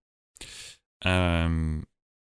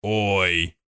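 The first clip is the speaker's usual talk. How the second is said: put-on Australian accent; 3 semitones higher, 3 dB louder than usual. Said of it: "if he was Australian, I would be like".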